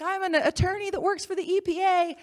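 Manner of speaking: high-pitched imitated shouting; retold screaming. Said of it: "this woman screams at me".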